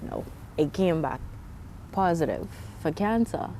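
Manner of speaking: soft, low delivery of bad news; quietly quoting herself, subdued; subdued retelling. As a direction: drop the voice to recount breaking it to mom. Telling mother the cancer result.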